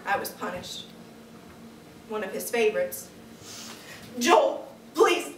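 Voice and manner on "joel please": frightened plea. Acted. Begging Joel to stop.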